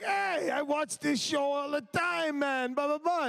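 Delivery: high, slow goofy cabbie voice. Retold or imitated. Cabbie telling Joe he watches the show, high and slow. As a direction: stretch it out in a silly high cabbie voice. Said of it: "And then he's like".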